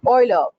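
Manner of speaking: "Euler" as OY-la; "Euler" with an OY opening, falling; corrected pronunciation. Shown as a demonstration. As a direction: start "Euler" like oil. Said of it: "it's called it as".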